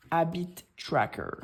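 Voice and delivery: slow, careful English-accented habit tracker. Real, not acted. Vlogger shows her habit tracker, in an English accent. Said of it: "Pardonnez mon accent, euh, anglais".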